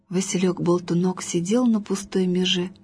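ordinary delivery

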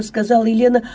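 ordinary delivery

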